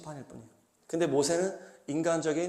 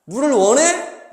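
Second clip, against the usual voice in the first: higher, louder, slow scornful question; taunting question; 8 semitones higher, 9 dB louder, slower than usual. Retold or imitated. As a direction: sneer the question at them. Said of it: "이렇게 되는 거예요".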